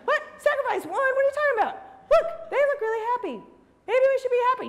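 hammed-up shock; cartoon outrage; high mock disbelief. Shocked member.

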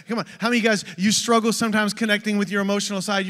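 ordinary delivery

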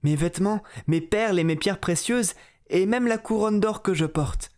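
put-on high eager young princess. Princess eagerly promising the frog, high voice. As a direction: promise everything in a rush of hope. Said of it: "lui dit la fille du roi".

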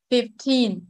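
put-on Fifteen with TEEN stressed; fif-TEEN, second syllable prominent. Shown as a demonstration. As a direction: put the weight on the end of Fifteen. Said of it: "nhấn trọng âm số hai".

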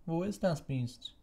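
slow, very high thin voice; thin old voice. A narrator's reading. Old committee member demanding the beast, slow thin voice. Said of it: "die dünne Stimme des Alten".

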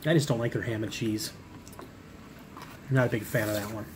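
chewing while talking; speaking around a mouthful; mouth full of food. Real, not acted. Seen 0: bit slider earlier, holds it, lips pursed chewing while speaking.

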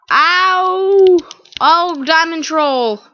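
high, drawn-out exclamation; yelp, then cry out slowly; loud outcry. Real, not acted. Gamer tricked by diamond troll, loud high outcry.

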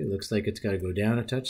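ordinary talk

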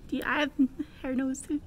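ordinary delivery, nothing marked

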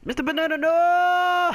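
high, loud wail of protest; hammed-up cry for a fallen comrade; dramatic cry. Cries out as teammate is hit.

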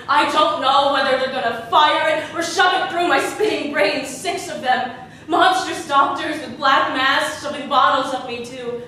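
loud, agitated cry of horror; anguished outburst. Acted. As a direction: let the horror burst out at full volume. Acted survivor recounting assault.

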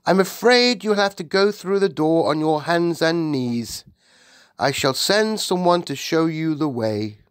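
high-pitched courteous royal voice; polite Queen. Acted. Queen directs the giant, polite and regal. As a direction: direct a guest apologetically.

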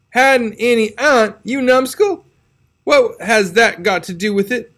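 put-on an old aunt throwing the word back at him; exasperated Aunt Polly. A narrator's reading. Aunt Polly scoffs at Tom's excuse, high and exasperated.

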